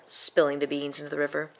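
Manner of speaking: plain reading voice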